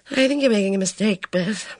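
plain reading voice

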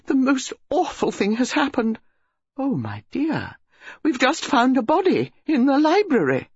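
high, agitated society lady; breathless, agitated. A narrator's reading. Mrs Bantry phoning Jane with shocking news, high agitated. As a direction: gasp out the news in a flurry. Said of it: "voice came breathless and agitated".

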